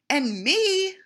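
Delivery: high, loud eager little voice; eager chime-in. A narrator's reading. The otter offers friendship, high and loud. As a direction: jump in brightly.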